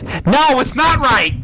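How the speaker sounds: high, loud frantic denial; shouted protest. Acted. Second character shouts back against the villain's plan, high and frantic. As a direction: yell it back in a panic.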